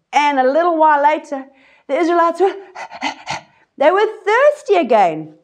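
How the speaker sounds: ordinary talk, nothing marked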